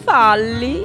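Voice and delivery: FALLI drawn out, rising at the end; questioning. Acted. Sculptor querying what people see, questioning. Same word turned into a question.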